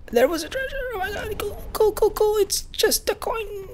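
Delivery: excited squeal. Real, not acted. Urging on, then let down.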